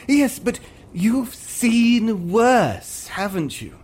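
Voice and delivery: very high, slow, drawn-out YES; pleading prompt. Acted. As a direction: stretch YES out, almost begging. Actor prompting the catchphrase louder.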